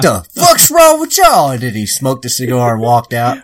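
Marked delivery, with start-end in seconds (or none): 0.0-1.5 high, slow, hammed-up outraged voice. Cohost imitating [name], high slow mock outrage. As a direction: drag it out like a scandalised hero.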